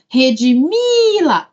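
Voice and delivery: rediMIIIla, MI stretched out; calling the word. Shown as a demonstration. Teacher hunting for the error, calling the word. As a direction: call out redimila, holding MI.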